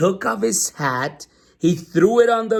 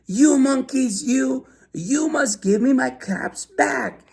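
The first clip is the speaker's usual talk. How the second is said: high, slow mock shout; shouting peddler; 10 semitones higher than usual. A narrator's reading. Very angry peddler shouting at the monkeys. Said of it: "very, very angry ... shouted".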